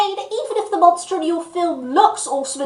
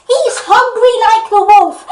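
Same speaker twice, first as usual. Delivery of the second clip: shouting; 5 semitones higher, 9 dB louder than usual. Pretend. Joke punchline about wolf.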